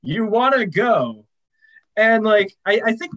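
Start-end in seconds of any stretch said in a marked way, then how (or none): 0.0-1.2 slow, higher mock challenge; fake tough guy swagger. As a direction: drag out the taunt like a bro. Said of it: "just does the".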